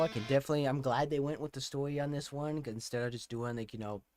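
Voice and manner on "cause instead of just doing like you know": usual speaking voice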